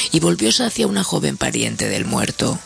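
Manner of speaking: plain reading voice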